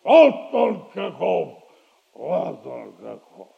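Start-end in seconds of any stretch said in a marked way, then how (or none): 2.1-3.5 much softer and lower; self-satisfied, dropped to a low murmur; low quiet echo. Acted. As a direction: repeat the boast under your breath. Boast repeated to himself.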